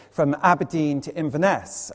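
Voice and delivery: ordinary talk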